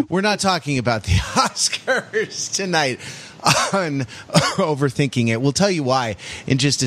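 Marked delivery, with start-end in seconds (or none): 0.0-4.1 high, words broken by laughter; cracking up while talking; laughing. Real, not acted. Breaks up laughing mid-sentence.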